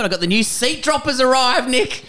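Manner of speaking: bubbling over with excitement; excited. Real, not acted. Telling his mate the new seat droppers arrived, excited. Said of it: "I'm so excited".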